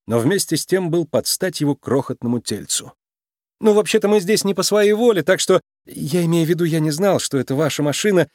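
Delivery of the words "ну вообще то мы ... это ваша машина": mildly nervous, higher-pitched excuses. A narrator's reading. Nick excuses himself to the mob boss, mildly nervous. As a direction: backpedal with a touch of nerves. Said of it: "нервно сглотнув".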